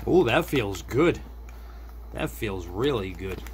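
ordinary talk

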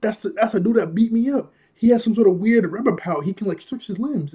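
excited. Retold or imitated. Retelling telling Buggy excitedly.

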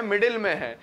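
ordinary talk

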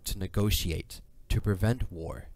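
normal talking voice

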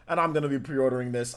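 ordinary delivery

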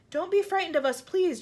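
high, loud appeal; calling out. A narrator's reading. James pleading with frightened men below, calling out. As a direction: call down to reassure them. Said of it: "James called out".

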